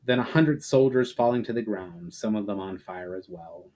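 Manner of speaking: ordinary delivery, nothing marked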